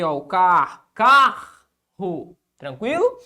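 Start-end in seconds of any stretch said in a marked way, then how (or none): 0.9-2.4 "carro" with a loud, drawn-out first syllable; stress on CAR. Shown as a demonstration. Teacher showing the tonic syllable, emphatic CAR in "carro". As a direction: lean hard on the CA of "carro". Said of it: "Também é a sílaba tônica".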